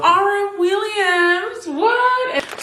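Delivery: high, slow gasp of surprise; surprised gasp. Real, not acted. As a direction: gasp at the label. Surprised at boot brand.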